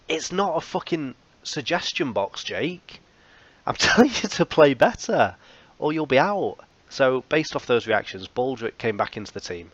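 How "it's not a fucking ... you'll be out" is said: high, loud mock scolding with a laugh; half-laughing boss rant; pretend-angry manager cracking up; mock telling-off.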